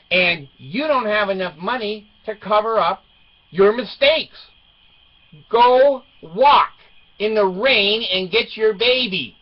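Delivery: loud, high, word-by-word parental orders; retold stern lecture. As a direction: drive each order home loudly to a daughter. Dad retells lecturing his daughter.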